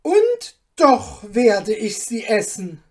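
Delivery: a lord swearing in wounded pride; blazing shout; defiant furious duke. Acted. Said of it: "rief er mit funkelnden Augen".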